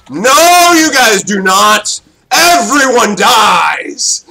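big, slow, shouted threat; loud hype taunt. Real, not acted. Gamer yelling at enemies during team wipe, shouting. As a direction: roar it at the enemy team.

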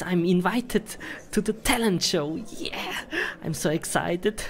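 excited whisper; hushed thrilled whisper. Real, not acted. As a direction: whisper the news like a secret.

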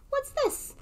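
very high puzzled question; surprised. Acted. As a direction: sound suddenly curious. Surprised discovery.

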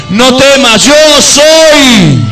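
No temas, yo soy shouted high and loud; shouted proclamation. Acted. Preacher crying God's words out to the congregation, shouting. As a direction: build to a full shout on yo soy.

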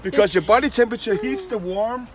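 ordinary delivery

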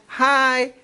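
hunting for the middle note, a few tries; middle pitch. Shown as a demonstration. Coach finding his middle pitch, searching hai. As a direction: slide the greeting toward your middle range. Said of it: "di sini adalah nada tengahnya".

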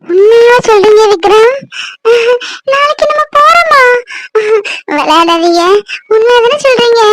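crying, halting hopeful questions; tearful disbelief. Acted. Wife asks if they are really going, tearful disbelief. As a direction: ask through tears, barely believing.

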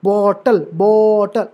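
बॉ in bottle held long, टल let go; bottle with a long, stressed बॉ; stress on first syllable. Shown as a demonstration. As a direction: demonstrate bottle leaning on बॉ. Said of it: "यहाँ जो pitch है, high pitch है".

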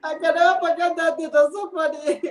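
can barely get it out for laughing; giggling helplessly while retelling the pastor's joke; laughing hard. Real, not acted.

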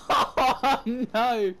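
high, loud oh no; alarmed cry. Real, not acted. Friend's character crashes.

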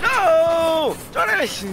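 very high, loud wail; desperate shout. Real, not acted. Player crying out to his teammate, desperate shout. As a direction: scream for your teammate.

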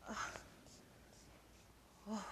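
muttering in mock disgust at the stink; disgusted by smell. Acting out the bathroom smell.

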